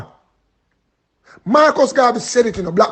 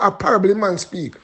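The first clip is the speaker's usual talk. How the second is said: normal talking voice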